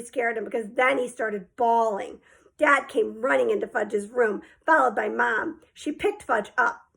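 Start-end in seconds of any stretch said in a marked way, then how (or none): none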